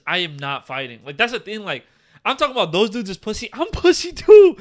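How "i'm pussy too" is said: loud, high, drawn-out exclamation; emphatic confession. Real, not acted. Admitting own cowardice to the co-host, loud emphatic outburst.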